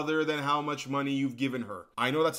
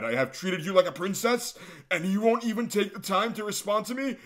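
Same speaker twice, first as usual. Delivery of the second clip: high, whiny demanding voice; mock needy fan; 5 semitones higher, 4 dB louder than usual. YouTuber mocking the fan's angry texts in a high whiny voice. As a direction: play the clingy fan getting upset.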